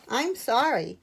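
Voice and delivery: ordinary delivery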